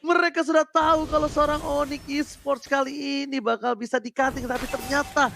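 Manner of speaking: rising hype play-by-play call; fighting game announcer. Acted. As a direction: build the hype through the kills. Caster calls multi-kills.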